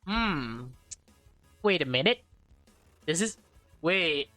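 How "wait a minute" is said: ordinary delivery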